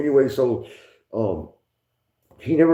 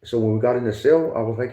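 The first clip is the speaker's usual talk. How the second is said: ordinary delivery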